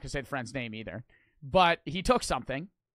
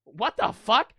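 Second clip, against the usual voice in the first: incredulous; 5 semitones higher, 5 dB louder, slower than usual. Retold or imitated. Acting out his bewildered reaction to friend.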